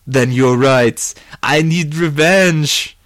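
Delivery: loud, rising vow; worked-up cry for revenge; vengeful resolve. Acted. Johnny is won over to revenge.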